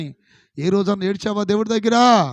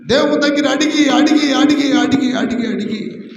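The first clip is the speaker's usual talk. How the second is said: loud, higher, rapid insistent repetition; urgent preaching; 6 semitones higher, 6 dB louder than usual. Acted. Pastor urging believers to keep asking, loud insistent. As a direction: drive the word home again and again.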